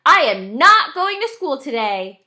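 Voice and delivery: put-on loud defiant child's shout. A narrator's reading. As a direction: shout it out, stubborn kid. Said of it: "stood right next to their bed and shouted".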